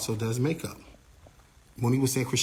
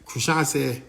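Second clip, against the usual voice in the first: ordinary delivery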